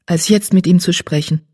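plain reading voice

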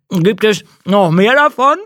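talking through a mouthful; mouth full. Acted. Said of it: "mit vollem Mund".

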